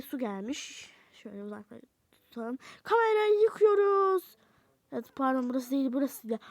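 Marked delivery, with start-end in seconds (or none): 2.8-4.2 high shrieking cry. Acted. Camera dunked in water.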